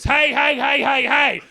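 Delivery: high, loud alarmed yell; outraged high-pitched hollering; angry shouting. Retold or imitated. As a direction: confront an intruder at full shout. Warehouse man confronts intruder.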